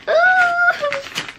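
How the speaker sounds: high delighted squeal; sudden loud happy scream; excited shriek. Real, not acted. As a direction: shriek with joy at the parcel. Opening gift parcel.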